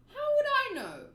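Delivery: loud, high, careless comeback; shouted shrug of a reply; voiced Luffy. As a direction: toss it off loudly like a carefree kid. Luffy brushes off Koby's question.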